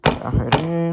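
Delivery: talking with phone in mouth; muffled, phone gripped in teeth; phone held in teeth. Real, not acted. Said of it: "I'm gonna try holding the, the phone with my mouth".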